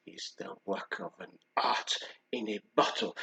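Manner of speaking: whispered with reverence; a fragrance maker's hushed reverent line; hushed awe. Retold or imitated. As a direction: breathe it like a secret. Whispered reverent quote of perfumer.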